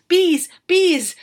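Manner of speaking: high, loud frantic shout; girl frantically crying out, high and loud. A narrator's reading. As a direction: cry it out wildly. Girl's frantic thought.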